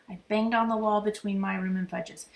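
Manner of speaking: usual speaking voice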